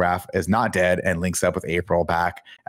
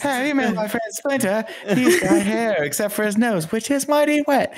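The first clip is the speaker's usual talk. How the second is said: much higher, slow mock British voice; oddly British lady; slower than usual. Mock British April describing Splinter, high and slow. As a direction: play a posh British lady, drawn out. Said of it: "lady sounded oddly British".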